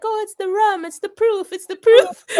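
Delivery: put-on high, loud laughing Jamaican accent; playful Jamaican accent, rising into laughter. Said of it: "my Jamaican voice is like".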